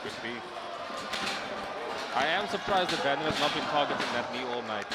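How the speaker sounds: ordinary talk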